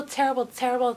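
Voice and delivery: ordinary talk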